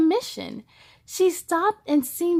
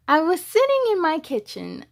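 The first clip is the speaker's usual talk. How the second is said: story narration; 3 semitones higher than usual. A narrator's reading. Reading picture book aloud.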